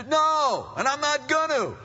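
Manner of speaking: high, loud bratty refusal; mock defiant child. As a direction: shout back like a stubborn kid.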